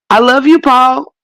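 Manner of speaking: high, sugary family goodnight; Waltons family goodnight. Retold or imitated. Mocking The Waltons family with a high sugary goodnight. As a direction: coo it like a sweet TV family. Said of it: "And in that movie".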